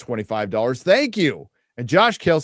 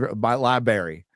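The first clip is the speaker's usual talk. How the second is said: usual speaking voice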